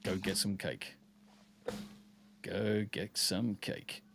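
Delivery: very soft, low murmur, slowing down on the repeat; hushed joking aside. Pretend. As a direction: drop right down to a quiet, playful urging. Joking aside repeated after shouted sign-off.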